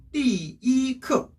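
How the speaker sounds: "이" high and level between "띠" and "크"; first-tone 이 as ordinal. Shown as a demonstration. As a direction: hold "이" on a high flat note. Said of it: "일 성으로 발음이 돼요".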